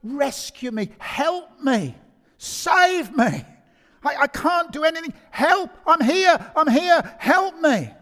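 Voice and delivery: high, loud desperate shouts, building; crying for help. Acted. Drowning swimmer shouts to a lifeboat for rescue, crying for help. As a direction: shout out like someone drowning who spots a lifeboat. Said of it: "You cry".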